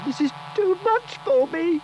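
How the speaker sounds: put-on high, loud panicked child scream. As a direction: yell it, terrified and hurt. Child pulled by crocodile.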